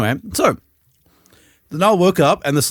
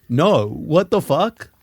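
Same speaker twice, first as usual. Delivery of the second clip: slow indignant; 3 semitones higher, much slower than usual. Retold or imitated. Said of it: "he's like".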